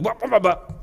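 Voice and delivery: loud, high wah-wahs; stalling truck noise by mouth; imitated truck lurch. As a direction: mimic a truck bucking as the clutch pops. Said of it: "It's like".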